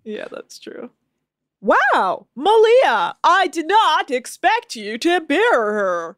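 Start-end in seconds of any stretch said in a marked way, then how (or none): 1.6-6.2 high, loud, slow fake astonishment; badly faked surprise, hammed up; mock surprise. Mocks Adam's staged surprise.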